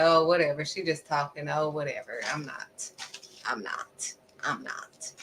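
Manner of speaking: low, quiet mock grumble; dismissive muttering. Listeners mimicked as she just talks, dismissive muttering. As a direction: brush it off under your breath, fading away.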